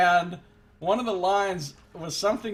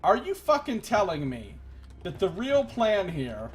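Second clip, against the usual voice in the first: incredulous rant; 5 semitones higher, 5 dB louder than usual. Real, not acted. Ranting about the quest plan.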